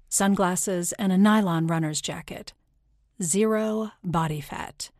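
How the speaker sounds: plain reading voice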